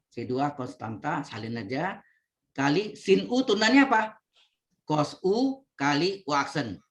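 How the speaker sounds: ordinary talk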